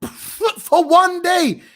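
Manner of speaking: slow, high, breaking into laughter; "For one day" dragged out slowly through laughter; laughing in disbelief. Real, not acted. Mocking the one-day suspension.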